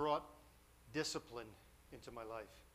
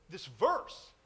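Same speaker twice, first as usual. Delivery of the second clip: louder, higher mock indignation; incredulous outburst; 4 semitones higher, 6 dB louder than usual. Classmate confronting him about yearbook verse, incredulous. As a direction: throw it out in disbelief.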